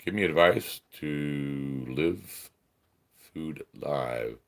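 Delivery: low, slow, puzzled murmur; quiet reading. Real, not acted. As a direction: read it softly to yourself. First reading of a chat message.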